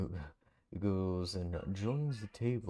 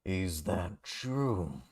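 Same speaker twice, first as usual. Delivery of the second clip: slow taunt; 3 semitones lower than usual. Acted. Character taunting slowly.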